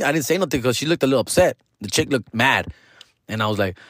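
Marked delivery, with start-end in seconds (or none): none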